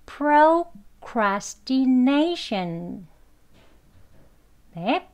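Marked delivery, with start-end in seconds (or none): 0.1-3.1 procrastination very slowly, pro-cras-tee-NAY-shun; careful full pronunciation. Shown as a demonstration. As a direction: spell out procrastination by sound, full "proh" and "tee". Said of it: "이렇게 또박또박 발음하진 않지요". (procrastination을 한 음절씩 또박또박)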